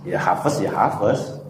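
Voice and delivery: "hafes" with a rising end; the local way of saying "hafes"; Hafs reading. Shown as a demonstration. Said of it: "Misalnya di sini".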